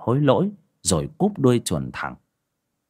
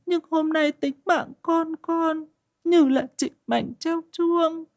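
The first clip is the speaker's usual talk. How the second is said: humble prayer; slower than usual. A narrator's reading. Mother kneeling at the well prays to the sect's founders, high slow and reverent. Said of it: "miệng nói những câu khó hiểu".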